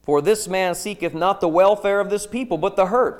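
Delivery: loud, insistent demand; forceful reading. Acted. Princes demanding Jeremiah's death, loud and insistent. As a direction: press the king hard for his death.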